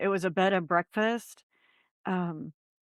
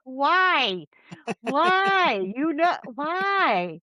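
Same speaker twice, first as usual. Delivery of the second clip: incredulous why; 7 semitones higher, much slower than usual. Retold or imitated. Reacting to Tim wanting to go back, high incredulous why. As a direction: say why as if he has lost his mind, slowing at the end.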